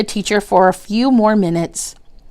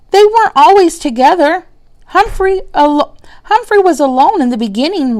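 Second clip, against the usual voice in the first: put-on eager child; 8 semitones higher, 4 dB louder than usual. Child argues earnestly.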